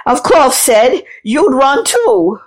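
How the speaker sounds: high, gasping weaver; wheezing. A narrator's reading. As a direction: wheeze it out, drawing out the end. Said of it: "And the weaver wheezed".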